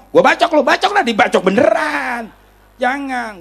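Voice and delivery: high, heated outburst, stretched at the end; acted angry threat. Man threatening to hack his opponent, hot-headed and high. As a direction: play a hothead threatening someone. Acts out threat not to answer back.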